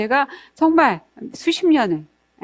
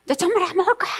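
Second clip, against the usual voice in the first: put-on higher, childlike sulky refusal; refusing child; 8 semitones higher, much faster than usual. Lecturer imitating a child who refuses to eat, sulky and pouting. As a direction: pout it out like a stubborn toddler. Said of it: "이렇게 하거든".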